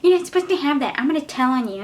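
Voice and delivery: mock angry teacher. Teacher lady catching the student with a phone, mock scolding. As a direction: imitate a snitty teacher threatening to report her. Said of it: "She was like".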